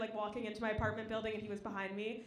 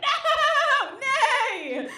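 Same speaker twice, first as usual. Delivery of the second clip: high, loud mock neigh of panic; a hammed-up horse's frantic no; panicked horse yell; 13 semitones higher, 12 dB louder than usual. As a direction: shout it like a spooked horse. A guy bucking like a horse.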